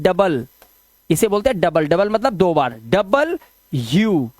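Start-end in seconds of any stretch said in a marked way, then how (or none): 2.9-4.3 डबल यू slowly, as two separate words; split pronunciation. Shown as a demonstration. Teacher building the letter's name, split pronunciation. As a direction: pause between "double" and "you" in डबल यू. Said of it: "तो ये होता है".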